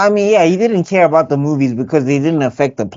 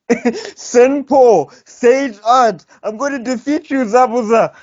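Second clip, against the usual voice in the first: anime attack call; 6 semitones higher, slower than usual. Acted.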